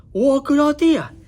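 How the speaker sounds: high, bright dragon voice; squeaky young dragon, delighted; eager dragon Zog. A narrator's reading. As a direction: chirp it out like a happy young dragon. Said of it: "said Zog".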